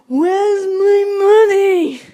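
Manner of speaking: put-on high, loud, drawn-out old man yell; old man's shout. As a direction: bellow it as the frantic old man. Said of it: "and shouted".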